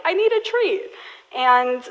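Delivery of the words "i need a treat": much higher, pitiful puppy plea; dog's sad pleading. Retold or imitated. Dog giving puppy eyes for a treat, higher sad plea. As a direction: beg like a sad little puppy. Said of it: "and she'll be like".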